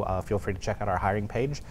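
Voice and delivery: usual speaking voice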